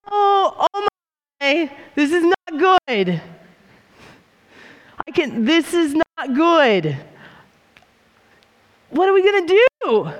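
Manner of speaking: high, alarmed, half-laughing panic; a servant seeing the enemy army, freaking out; panicked servant. Acted. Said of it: "this is the Margie version".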